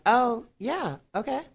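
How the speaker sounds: high, drawn-out mock realization; feigned recognition. Agent covering her surprise on call.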